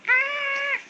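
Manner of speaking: high, sustained "ah"; high held cry to coax a scream; demonstrated scream. Shown as a demonstration. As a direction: model a short, controlled high scream. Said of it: "Dá um grito".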